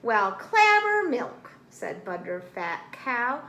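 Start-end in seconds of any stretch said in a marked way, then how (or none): 0.0-1.5 slow, high, loud exclamation; loud bovine oath, drawn way out; astonished cow. A narrator's reading. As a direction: drawl it out in big amazement.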